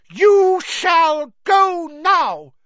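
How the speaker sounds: very loud, high, slow elf command; fierce high-pitched elf shout; fierce Dobby. A narrator's reading. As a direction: hammer out each word fiercely, high and slow. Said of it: "he said fiercely".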